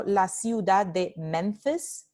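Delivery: normal talking voice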